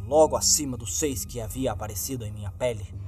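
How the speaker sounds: plain reading voice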